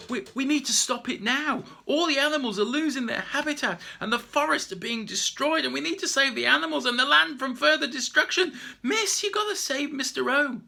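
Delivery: high, urgent plea; urgent pleading. Acted. Peter pleading with the teacher to save Mister O, urgent. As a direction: beg her to act now.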